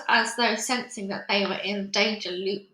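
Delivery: ordinary delivery, nothing marked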